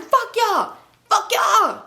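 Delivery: high imitated outburst; put-on angry cursing; mock cursing yell. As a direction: holler it back as in a replayed row. Husband cursing back, acted.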